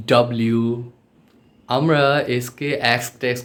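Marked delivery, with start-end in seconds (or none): 0.1-0.6 "ডাব্লিউ" run together as one word; connected, emphatic "ডাব্লিউ"; joined-up letter W. Shown as a demonstration. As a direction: join the parts of "ডাব্লিউ" smoothly. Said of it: "একসাথে বললে".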